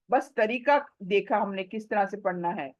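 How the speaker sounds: ordinary delivery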